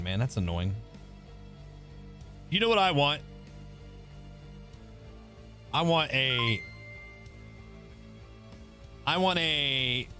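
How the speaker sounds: ordinary talk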